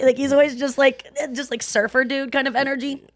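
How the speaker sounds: high, laid-back surfer voice; surfer dude. Retold or imitated. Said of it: "a guy that talks like this".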